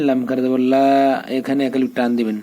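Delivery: normal talking voice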